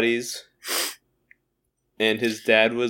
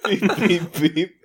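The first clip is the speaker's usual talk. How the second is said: toy robot voice; 6 semitones higher, slower than usual. Pretend. Acting out a voicemail beep.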